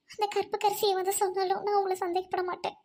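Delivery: ordinary talk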